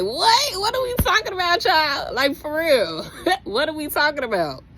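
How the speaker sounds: a disbelieving squeal that ends in a laugh; incredulous outburst. Real, not acted. Venting to viewers about the person's accusation, high incredulous laughing.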